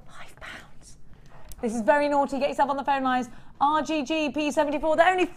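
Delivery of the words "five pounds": slow whisper, as if sharing a secret; conspiratorial whisper. Acted. Host letting viewers in on a bargain, slow whisper. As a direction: lean in and whisper the price.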